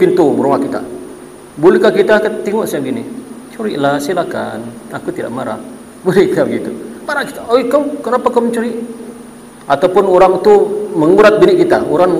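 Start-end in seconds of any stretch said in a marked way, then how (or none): 3.5-5.6 much softer, lower limp permission; mock meek. The homeowner meekly lets the thief in, much softer. 7.5-8.8 mock confrontation. Confronting a thief.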